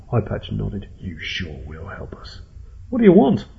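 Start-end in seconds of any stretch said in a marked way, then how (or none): 1.0-2.4 low, slow, quiet threat; softly sinister gang leader; menacing possum boss. Acted. 2.9-3.5 very high, loud frightened question; frantic high-pitched plea; panicked demand. Acted. Captive pleads with his captors.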